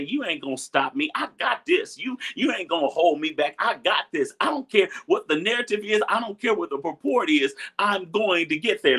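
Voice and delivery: high, defiant declaration to yourself; defiant self-talk. Pretend. Pastor voicing his mind talking back to obstacles, high defiant. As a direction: tell every obstacle it won't stop you.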